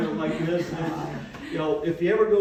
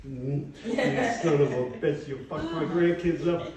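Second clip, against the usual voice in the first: laughing. Real, not acted. Laughing roast remark.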